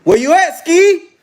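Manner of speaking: loud, high call across the room. Real, not acted. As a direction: shout for Ski to answer. Calling out to absent Ski.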